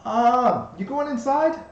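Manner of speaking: high, loud tiny bug voice; pixie. Acted. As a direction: shout up from the ground like a tiny ladybug. GM voices the ladybug.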